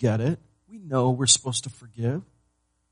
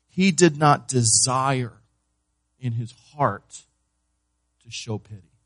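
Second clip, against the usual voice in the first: ordinary talk, nothing marked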